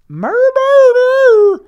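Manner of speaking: very high, very loud shrieking word; shrieking high creature yell; Grimace creature scream. Retold or imitated. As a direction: scream it like a monster. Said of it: "Grimace go".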